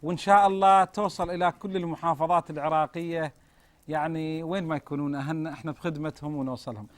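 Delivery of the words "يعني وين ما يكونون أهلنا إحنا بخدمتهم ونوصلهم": usual speaking voice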